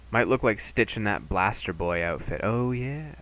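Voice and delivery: ordinary talk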